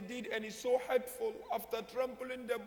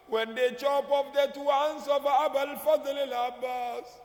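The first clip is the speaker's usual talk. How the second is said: grieving cry; 2 semitones higher, 5 dB louder, slower than usual. Acted. Sakina crying out to her grandfather and father, wailing loud. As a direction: call out to the dead in anguish.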